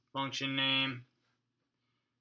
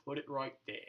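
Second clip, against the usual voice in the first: slow, lower-pitched mock voice; drawn-out, put-on talk, pitched down; put-on silly voice; 3 semitones lower, 5 dB quieter than usual. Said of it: "I wasn't gonna talk like that".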